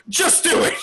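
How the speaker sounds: high-pitched exasperated mock yell; high, urgent outburst. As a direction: blurt it out high, as an order. Acted urgent yell of Luffy.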